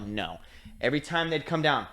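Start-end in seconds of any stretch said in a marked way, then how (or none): 0.0-0.4 low quiet flat no; mock ref refusal. Referees refusing the run, low quiet no. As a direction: drop it low and dismissive. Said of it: "they're like".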